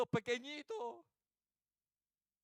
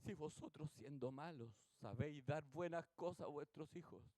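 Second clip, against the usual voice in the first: lower, softer recitation; measured scripture quote; 5 semitones lower, 5 dB quieter than usual. Real, not acted. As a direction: recite the verse with restraint. Recita el versículo, primera vez. (versículo recitado en voz baja y contenida)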